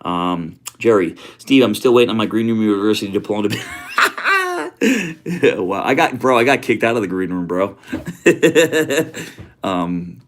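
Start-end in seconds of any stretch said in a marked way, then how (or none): 3.7-5.6 high burst of laughter. Real, not acted. Laughs at diploma joke. 7.8-9.6 hearty laugh. Real, not acted. Laughs about being kicked out.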